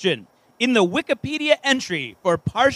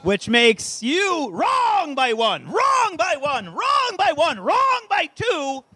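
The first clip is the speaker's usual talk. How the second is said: gloating shout; 7 semitones higher, slower than usual. Acted. Gleefully scolding wrong guesses.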